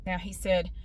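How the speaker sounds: ordinary delivery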